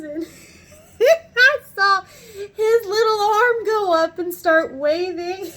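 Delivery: high, loud, breaking with laughter; amused retelling. Retold or imitated. Supervisor describing his worker waving, tickled and laughing.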